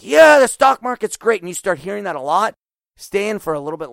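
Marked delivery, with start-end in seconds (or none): 0.0-1.4 high, loud mock cheer; imitated cheering crowd. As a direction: whoop it up like a smug crowd of investors. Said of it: "everyone's like".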